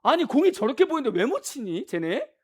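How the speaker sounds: loud, high-pitched exasperated rant; riled-up fan. Acted. Irritated TV viewer griping about the hitters, riled up. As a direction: complain heatedly at the batters. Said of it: "아, 그러니까 열받잖아요".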